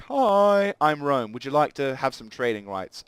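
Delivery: a high, loud "Hi", then a friendly offer; cheery diplomat greeting. Pretend. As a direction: greet them like an eager salesman. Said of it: "and we're like".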